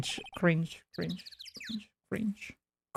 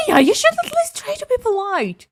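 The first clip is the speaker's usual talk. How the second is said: put-on much higher, bright scolding girl voice; high chirpy girl voice. Voicing a character.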